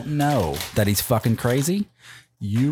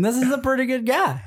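laughing mock quote; 14 semitones higher than usual. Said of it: "and thinking".

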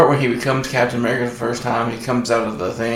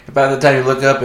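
ordinary talk, nothing marked

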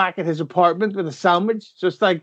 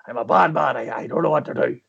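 Liam Neeson impression; 5 semitones lower than usual. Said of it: "Every film, that's what he does".

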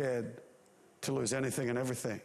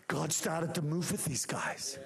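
ordinary delivery

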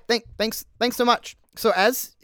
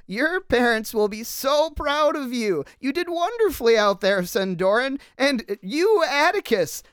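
higher, gushing praise from a proud teacher; proud professor; 7 semitones higher than usual. Acted. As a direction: beam with pride as the professor. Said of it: "And she says".

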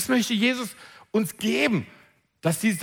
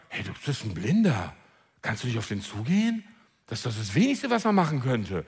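much lower, scornful bystander voice; mocking bystander; 10 semitones lower than usual. Bystanders scold the blind man, low and scornful. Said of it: "wir sagen".

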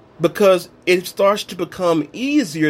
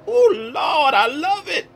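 high, crying-out joy; higher, near-shout of mock delight over the phone number; gushing delight; 11 semitones higher than usual. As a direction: gush over it like a treasure. Delight at his easy number.

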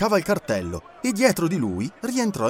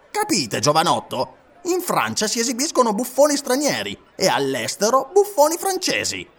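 hearty showman offer; 8 semitones higher, 3 dB louder than usual. A narrator's reading. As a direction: sell him the clown job. Batulcar pitching the job.

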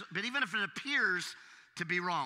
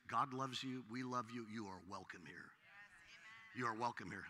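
much softer, lower, tender reassurance; gentle welcome; 8 semitones lower, 12 dB quieter than usual. Real, not acted. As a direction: softly welcome them in.

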